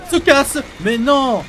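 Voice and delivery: panicked yell. Real, not acted. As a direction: yell out in alarm. Monster catches player.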